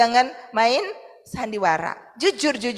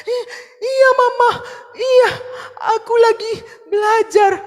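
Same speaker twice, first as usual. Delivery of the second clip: put-on high, loud sobbing child's voice; crying child; 9 semitones higher, 4 dB louder than usual. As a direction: answer Mama through tears. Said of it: "Lalu dia". (anak kecil menjawab sambil menangis keras)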